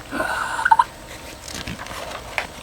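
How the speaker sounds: breathy, voiceless gasp; acted gasp. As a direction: gasp like a straining mime. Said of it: "and he's like".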